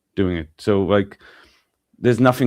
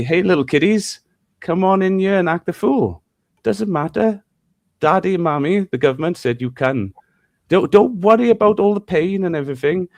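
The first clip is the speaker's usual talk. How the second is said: luring corporation; 6 semitones higher than usual. Retold or imitated. Father telling son how the corporation lures kids, in a higher sly coaxing voice. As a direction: coax the kids in like a con man. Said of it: "like Pinocchio, they say".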